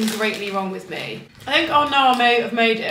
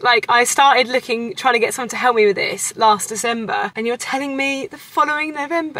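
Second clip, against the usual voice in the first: ordinary talk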